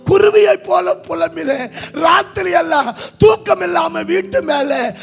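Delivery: loud, rising pulpit reading of scripture; fervent preaching. Acted. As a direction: proclaim the verses with fire. (ஆவேசமான பிரசங்க வாசிப்பு)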